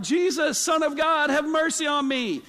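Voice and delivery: high, loud desperate cry; yelling for mercy. Retold or imitated. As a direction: call out to Jesus over the crowd. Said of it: "he was the one yelling".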